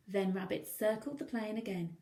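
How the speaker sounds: ordinary delivery, nothing marked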